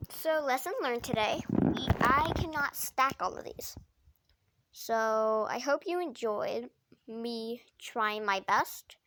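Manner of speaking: ordinary talk, nothing marked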